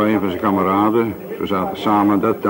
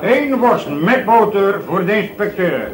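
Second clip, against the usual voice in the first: raised voice calling across the café; calling out order; 10 semitones higher, 3 dB louder, slower than usual. Acted. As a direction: call the order out to the kitchen. Barman calls order to kitchen.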